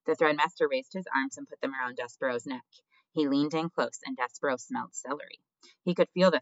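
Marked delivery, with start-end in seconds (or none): none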